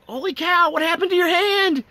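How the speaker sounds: high startled cry; shocked at the missing fingers; high, shocked holy cow. Retold or imitated. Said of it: "And I'm just like".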